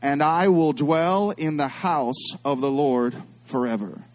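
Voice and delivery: slow, solemn congregational recitation; drawn-out scripture recital; recited together. Real, not acted. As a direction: lead the room through the closing line slowly. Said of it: "say this last part with me".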